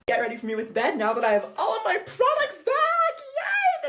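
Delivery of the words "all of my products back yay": much higher, louder cheer; celebrating the suitcase's return; excited yay. Real, not acted. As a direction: cheer in relief. Suitcase finally retrieved.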